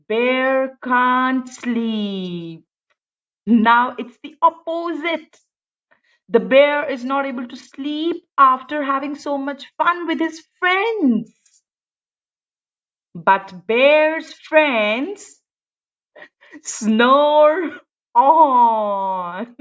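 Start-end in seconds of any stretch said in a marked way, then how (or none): 0.1-2.6 very slow, drawn-out ending. Acted. 13.1-19.5 slow, drawn-out closing line; slow storybook ending. Acted.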